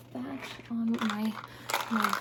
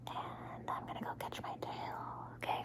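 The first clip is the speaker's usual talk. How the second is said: whispering dog character; 10 dB quieter than usual. Pretend. Pretend dog taking leave of the listener, whispered. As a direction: draw it out in a tiny whisper. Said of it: "whisper in your ear".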